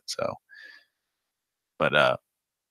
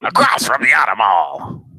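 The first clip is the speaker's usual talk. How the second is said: a hint of the car-ad pitchman; car dealer voice; 4 semitones higher, 5 dB louder than usual. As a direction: echo the cheesy car ad. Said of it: "he sounded like this".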